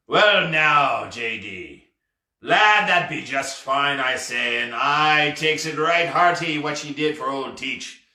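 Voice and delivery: slow, drawn-out hearty pirate talk; old sea dog savouring every word; satisfied pirate captain. Acted. Triumphant pirate declaring the lad his shipmate, hearty and slow.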